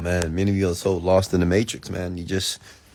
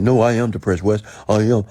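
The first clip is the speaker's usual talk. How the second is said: louder, higher protest imitating a challenger; mock insistence; 3 semitones higher, 6 dB louder than usual. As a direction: insist on it like someone arguing back. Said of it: "how they're saying it".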